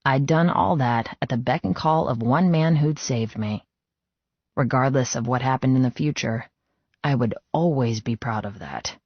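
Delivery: usual speaking voice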